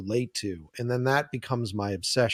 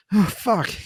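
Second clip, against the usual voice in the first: a sudden pained outburst, higher and louder; dismayed oh fuck; 7 semitones higher, 6 dB louder than usual. Retold or imitated. Editor seeing his clips, crushed and cursing. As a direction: let the heart drop out loud. Heart breaks in edit room.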